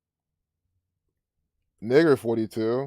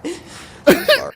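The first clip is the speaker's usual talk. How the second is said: mocking laugh; 7 dB louder than usual. Real, not acted. Laughs at crying reporter clip.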